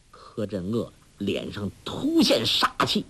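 usual speaking voice

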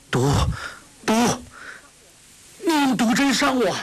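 high, stammering shout of alarm; panicked cry. Acted. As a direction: cry out in terror, stuttering the first word. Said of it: "叫道". (惊恐高声大叫)

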